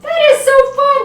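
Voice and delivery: high, loud gushing; delighted. Real, not acted. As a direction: burst out with squealing delight. Thrilled at the finished leaves.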